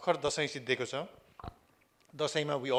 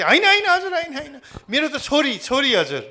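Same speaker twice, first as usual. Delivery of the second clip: much higher and louder, laughing as he corrects; eager correction; 12 semitones higher, 11 dB louder, much faster than usual. Retold or imitated. Son corrects his father about the daughter, high and laughing. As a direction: beam while setting him straight.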